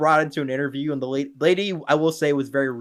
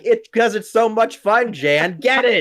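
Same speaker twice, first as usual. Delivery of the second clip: slow, loud, high mock yell; drawn-out mock outburst; imitating yelling; 6 semitones higher, 4 dB louder, slower than usual. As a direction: yell it back in exasperated imitation. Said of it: "he just yells at her".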